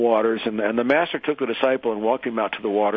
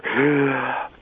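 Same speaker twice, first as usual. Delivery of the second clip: acted gasp after breaking the surface; gasp for air; 4 semitones higher than usual. Student bursting out of the water, big gasp for air. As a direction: suck in one big desperate breath. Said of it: "gets this big breath of air".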